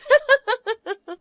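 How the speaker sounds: a high, mocking laugh at her sister. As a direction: laugh down at the introvert.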